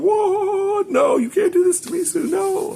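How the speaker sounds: shrill, loud falsetto disbelief; high-pitched shocked protest; shocked outcry. Retold or imitated. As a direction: squeal it like you can't believe it. Shocked reaction to plot twist.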